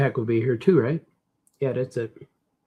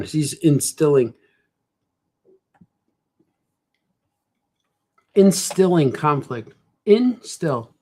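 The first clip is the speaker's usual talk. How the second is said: drawn out; 4 dB louder, slower than usual. Shown as a demonstration. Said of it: "instills conflict".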